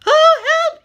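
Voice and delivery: very high, loud storybook yelp; hammed-up frightened squeal; panicked. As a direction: cry for help in mock terror. Fox frightened by the Gruffalo.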